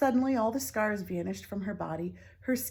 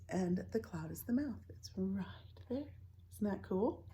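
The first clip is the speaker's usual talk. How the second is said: whisper; 7 dB quieter than usual. Real, not acted. Pointing out picture, whispered.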